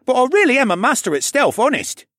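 put-on high, loud pleading kid; insistent boy voice. Kid insisting on his stealth.

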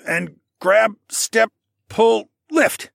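each word counted out, rising; calling out steps. Acted. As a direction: call each step like a drill.

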